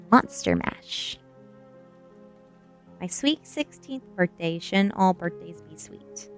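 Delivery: ordinary delivery, nothing marked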